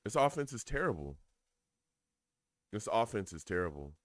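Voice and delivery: normal talking voice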